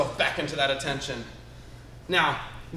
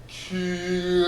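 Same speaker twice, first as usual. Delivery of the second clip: long, drawn-out slow-motion yell; slow-motion kiai; 3 dB quieter than usual. Shown as a demonstration. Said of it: "got the slow motion kia too".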